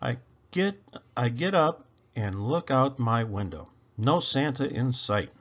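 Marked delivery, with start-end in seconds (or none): none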